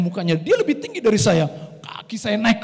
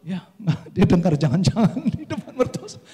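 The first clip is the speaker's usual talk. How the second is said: talking through chuckles; laughing. Real, not acted. Preacher recalls his outburst before in-laws, laughing as he talks. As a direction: let amused laughter break into the words.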